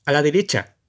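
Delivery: grito lejano y enfadado; grito furioso. Acted. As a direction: gritado con furia desde lejos. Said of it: "oíse de nuevo gritar furiosamente". (un cochero le grita furioso)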